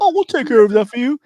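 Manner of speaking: high, sugary fake-helpful clerk voice; mock service rep. Mocking the bank rep to friends, high fake-helpful voice. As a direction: parody the chirpy customer service promise.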